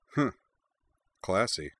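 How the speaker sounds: dry, sarcastic disapproval; sarcastic grunt. A narrator's reading. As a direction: scoff it with a frown. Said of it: "Utena grunted, frowning".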